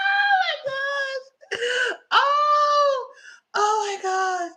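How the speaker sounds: very high, slow laughing cries; laughing hard. Real, not acted. Speaker overcome with laughter, high and crying out. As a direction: gasp it out between laughs.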